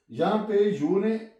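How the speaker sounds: normal talking voice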